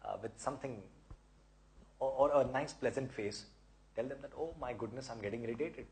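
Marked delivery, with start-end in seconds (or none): 4.4-5.9 softer, amused little admission; soft, smiling self-teasing; said with a smile. Shown as a demonstration. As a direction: shrug it off gently with a smile. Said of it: "with a smile".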